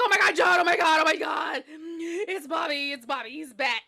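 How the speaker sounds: high, loud sobbing panic easing off; frantic, tearful outburst; hysterical mother. Acted. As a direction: babble in terror to your husband.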